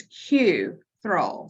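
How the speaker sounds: Q Thrall in two slow, separated parts; correct surname, KYOO-thrull. Shown as a demonstration. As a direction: say Q like the letter, then Thrall. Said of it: "is it Cuthrell or Cuthrell".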